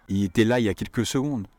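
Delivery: Il était là il y a quelques secondes run together quickly; casual rapid French; fast spoken form. Shown as a demonstration. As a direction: quelques secondes clipped to kèlke s'gond. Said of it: "So no more".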